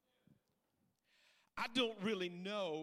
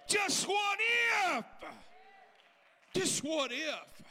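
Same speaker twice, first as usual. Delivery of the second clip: loud, high, rising to a chanted shout; full-force preaching; 4 semitones higher, 6 dB louder than usual. Acted. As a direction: build the congregation up to a roar.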